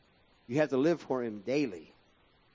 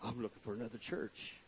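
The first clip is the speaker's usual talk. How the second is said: soft, lower mock sulky voice; mock offended churchgoer; 5 semitones lower, 13 dB quieter than usual. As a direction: play the huffy member leaving. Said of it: "If I said that to you".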